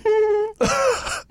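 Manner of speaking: laughing to tears; wheezing high laugh; high crying laugh. Real, not acted. Co-host in tears laughing at the pickup line.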